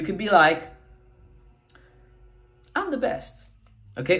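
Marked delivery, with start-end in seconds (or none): 2.7-3.4 upbeat, higher-pitched little boast; positive brag. Shown as a demonstration. Coach demoing a positive brag to students, upbeat. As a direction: say it brightly, pleased with yourself. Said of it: "You can do this in a positive way".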